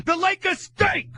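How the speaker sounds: slow, loud, high shout of disgust; angry shout. Real, not acted. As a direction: drag it out, yelling in disgust. Host rants, shouting verdicts.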